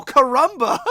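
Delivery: loud, high hammed-up exclamation; mock amazement. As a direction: cry out like a cartoon onlooker. Men react to sunbathing heroine.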